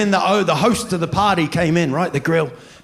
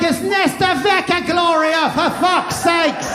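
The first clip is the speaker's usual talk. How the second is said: high, slow, shouted mock exasperation; mock fury at the confused costume-wearer; exasperated retort; 11 semitones higher, slower than usual. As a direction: yell it up high, fed up. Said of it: "You're like".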